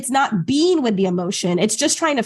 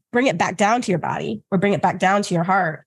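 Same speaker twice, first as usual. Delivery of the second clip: usual speaking voice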